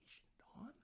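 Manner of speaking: breathy whispered question; puzzled whisper. Retold or imitated. Carl asking about the faction name, baffled whisper.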